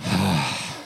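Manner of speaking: long soft sigh of relief; relieved sigh. Retold or imitated. Heart settles, a soft relieved sigh. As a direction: let the breath out slowly, relieved. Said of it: "my heart went".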